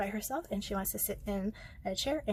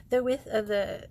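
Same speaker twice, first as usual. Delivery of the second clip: ordinary delivery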